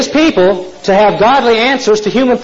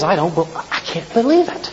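quiet disbelief; 8 dB quieter than usual. Acted. Farmer stunned, quiet disbelief.

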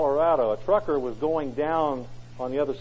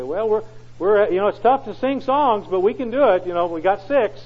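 hammed-up brave wife; 7 semitones higher, 7 dB louder than usual. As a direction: put on a brave face for the wife. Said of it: "and she'd say".

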